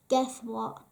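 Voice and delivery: usual speaking voice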